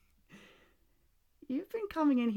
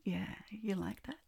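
whispering; 4 semitones higher than usual. Acted. Seductive teasing.

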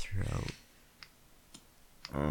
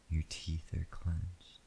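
whispering; 5 dB quieter than usual. Acted. Intimate whispered roleplay.

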